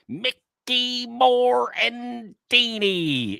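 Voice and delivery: high, very slow announcer sing-out; Howard Callis. Acted. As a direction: hold each syllable of the names long and high. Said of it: "intonation and pacing".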